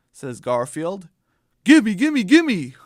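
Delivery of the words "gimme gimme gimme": loud, eager grabby chant; snatch at the lasagna hungrily; greedy cat begging loudly; greedy gimme. Acted. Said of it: "he says".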